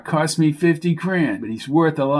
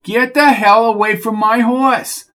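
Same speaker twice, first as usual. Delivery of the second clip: higher, louder furious yell; angry shout; 9 semitones higher, 5 dB louder than usual. Acted. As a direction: bark him away from the horse. Coco shouts at Bucky.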